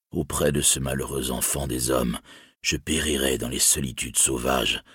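a weary warrior giving up hope; despairing elf warrior. Acted. Mablung speaks in despair.

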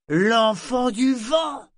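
cri aigu, très lent et étiré; exclamation. A narrator's reading. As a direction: lance le nom comme une révélation. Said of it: "s'exclama-t-il avec un grand sourire". (matelot ivre qui reconnaît soudain Brachen, crie ravi)

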